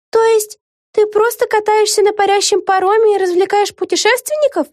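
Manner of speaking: very high-pitched questioning; incredulous question. Acted. Vega checks what Lucy actually does aboard, incredulous. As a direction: ask it in high-voiced disbelief.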